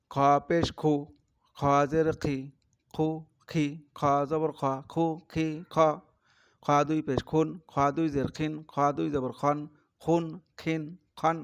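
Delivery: loud, emphatic letter drill. Shown as a demonstration. Teacher loudly drilling খ syllables for students. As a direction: call each syllable out firmly. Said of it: "teacher drills খ loudly".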